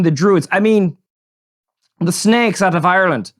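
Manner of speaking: hammed-up Irish brogue; horrible Irish accent. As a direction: greet the audience in a badly faked Irish accent.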